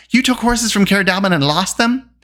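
high-pitched disbelief; incredulous accusation; shocked cry. A narrator's reading. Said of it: "cried Taran".

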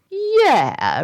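high, falling defiant retort; come-and-fight-me. Acted. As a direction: throw the challenge straight back. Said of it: "okay, then come on and fight me".

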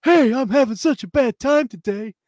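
high, loud mock dub actor voice; dubbed English voice. Mimicking the dub to his co-host, high and hammy. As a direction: ham it up like a cheesy English dub. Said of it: "the main guy's like this".

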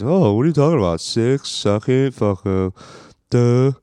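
high, loud sneering mockery; mocking dumb voice. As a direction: play a clueless know-it-all. Mocking critics of the rankings.

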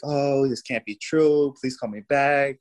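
retold tearful plea. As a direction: imitate distraught loved ones, without real tears. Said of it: "just crying".

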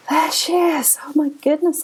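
high, wondering gasp of recognition; light up as if finding someone lost; amazed discovery. Retold or imitated. Retelling her breakthrough to the host, amazed and high. Moment of reconnecting with herself.

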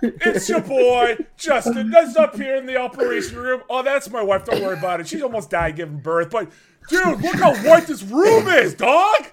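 vlogger. Pretend. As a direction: play the oblivious hype vlogger filming the delivery room. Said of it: "Just vlogging".

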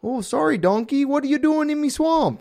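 high, loud Canadian Shrek impression; squeaky, loud mock Canadian accent. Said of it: "flip it right to Canadian".

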